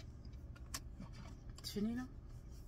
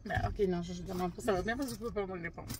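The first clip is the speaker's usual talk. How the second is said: ordinary delivery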